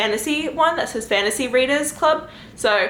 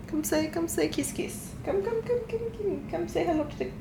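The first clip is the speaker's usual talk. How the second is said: cooing coaxing; 9 semitones higher, 9 dB quieter than usual. Real, not acted. Calling baby to come say hello, cooing. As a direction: quietly coo to lure a little one over.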